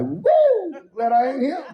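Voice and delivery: high whoop then relieved; seeing four big men arrive, relieved whoop. Retold or imitated. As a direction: glad it's not you, high and startled. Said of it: "I was like".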